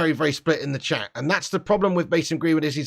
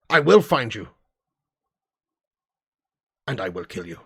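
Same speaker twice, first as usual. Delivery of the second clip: Liam Neeson; 4 semitones lower than usual. Acted. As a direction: deliver a movie hard-man ultimatum, dropping low at the end. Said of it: "Liam Neeson".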